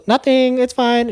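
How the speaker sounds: high, squeaky fake innocence; unconvincing. Vera unconvincingly reassuring the old mother at the door, squeaky fake innocence. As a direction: chirp it out, clearly lying. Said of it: "Vera's not convincing".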